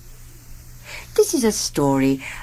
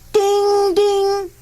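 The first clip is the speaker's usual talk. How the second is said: bell ding; 12 semitones higher than usual. A narrator's reading. Train bell sound in story.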